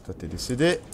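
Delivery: ordinary delivery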